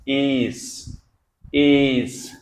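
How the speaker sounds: "एस" said clearly as ace, the a gliding into a short i; teacher modelling a word. Shown as a demonstration. Teacher drilling Hindi students on the ei diphthong, clear model voice. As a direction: repeat "एस" slowly so the class hears the ei glide. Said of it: "तो इसको क्या बोलेंगे हम?".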